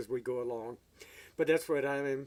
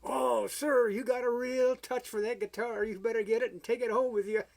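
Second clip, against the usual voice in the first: much higher, oily flattering salesman voice; 9 semitones higher, 3 dB louder than usual. Retold or imitated. Salesman flattering clumsy guitar player into buying, high oily voice. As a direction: flatter him shamelessly, pitched way up. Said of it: "And he".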